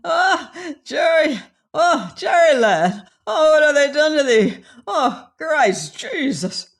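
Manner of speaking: anguished shriek, very high and loud; crying out, voice breaking in grief and terror; dreadful screaming. Acted. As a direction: scream in horror at a friend's fate. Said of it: "a sudden dreadful screaming".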